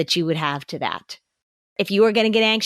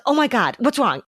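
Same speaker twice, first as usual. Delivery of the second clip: mock-anxious parent, higher pitch; anxious response; 5 semitones higher than usual. Parent hears of a child's sore tummy, anxious response. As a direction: overreact with a worried rise. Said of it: "the anxious response".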